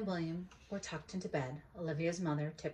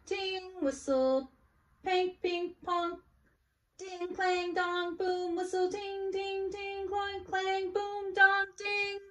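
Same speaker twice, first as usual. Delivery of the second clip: high sing-song sound words; instrument noises; 14 semitones higher, 6 dB louder, slower than usual. Acted. Olivia marching in as a band, high chiming. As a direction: chime through the band noises.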